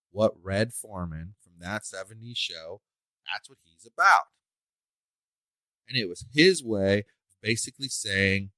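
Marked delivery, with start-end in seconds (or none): none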